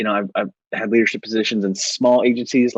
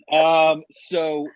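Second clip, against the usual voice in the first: normal talking voice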